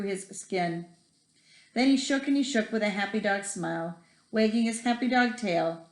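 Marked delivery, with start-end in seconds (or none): none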